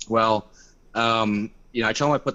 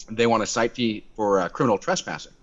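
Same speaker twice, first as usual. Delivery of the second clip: ordinary talk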